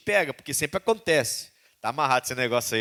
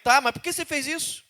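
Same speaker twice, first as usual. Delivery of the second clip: much higher, searching question; God asking why; 13 semitones higher, 3 dB louder than usual. Retold or imitated. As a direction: ask with pained insistence. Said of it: "Deus tá falando isso".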